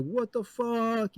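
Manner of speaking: high, slow drawn-out what the fuck; stunned disbelief. Retold or imitated. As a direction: stretch it out in shocked disbelief. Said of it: "The guide's like".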